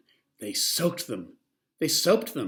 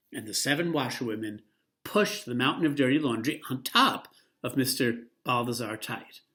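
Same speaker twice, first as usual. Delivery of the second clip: usual speaking voice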